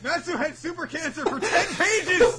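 high, loud incredulous outburst; hammed-up astonishment with laughter; mock exclamation. Mocking the absurd short plot.

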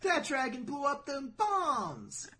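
high, drawn-out goofy amazement; mock radical kid. Kid impressed by an exploding dragon, mock awe. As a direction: stretch it like an easily impressed kid. Acted dim fan reaction to battle.

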